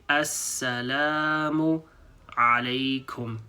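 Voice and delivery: "Assalamu alaikum" drawn out syllable by syllable; "Assalamu alaikum" stretched slowly; very slow greeting. Shown as a demonstration. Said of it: "to say the whole phrase, we say".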